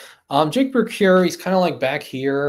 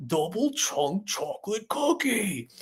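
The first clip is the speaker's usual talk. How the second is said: high, slow, stretched catchphrase; Costco guys; 9 semitones higher, 5 dB quieter, slower than usual. Retold or imitated. Husband working a viral phrase into his stream, Costco guys impression. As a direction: draw out each word in a high mock chant. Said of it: "Now I'm the Costco guys".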